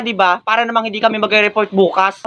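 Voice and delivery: usual speaking voice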